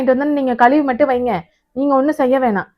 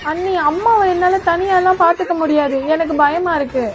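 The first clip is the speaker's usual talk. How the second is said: high, whiny put-on panic; pretend fear; 7 semitones higher than usual. Dodging caring for mother-in-law, she fakes high panicky fear. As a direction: fakes fright to dodge the chores. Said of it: "நாடகத்தை போடுறாளே".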